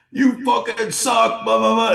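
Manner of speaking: high, loud imitated screaming at a player; retold heckler screaming abuse from the stands; mock furious yelling. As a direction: ham up a heckler losing it at the shooter. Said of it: "belligerently apeshit on him".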